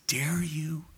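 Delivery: hushed, breathless "Dare you?"; whispered rebuke. Retold or imitated. Preacher voicing Paul challenging the Corinthians, in a whisper.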